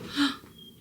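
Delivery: sharp high gasp of delight; happy surprised gasp. Real, not acted. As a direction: gasp at the pizza arriving. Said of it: "Is that pizza?".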